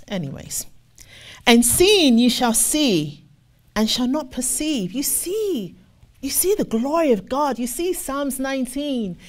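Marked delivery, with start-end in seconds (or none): 1.4-4.9 slow, weighty scripture read. Acted. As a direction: lean into each word of the verse. Reads scripture slowly.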